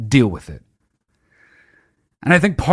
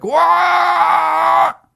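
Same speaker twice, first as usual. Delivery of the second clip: high, loud drawn-out shout; stretched, shrieking what; mock disbelief; 4 dB louder than usual. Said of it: "be like".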